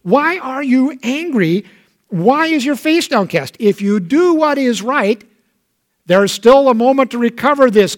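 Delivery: high, pleading fatherly voice; God pleading with Cain, pitched high; God scolding Cain. Acted.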